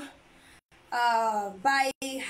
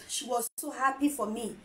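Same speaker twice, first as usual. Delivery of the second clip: ordinary delivery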